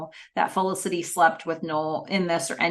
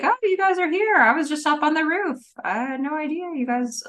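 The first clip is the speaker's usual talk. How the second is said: higher-pitched, lightly acted innocent excuse; feigning surprise; 9 semitones higher than usual. [name] telling his lie to the others, feigning surprise. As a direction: act mildly surprised to find them. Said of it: "say the same lie".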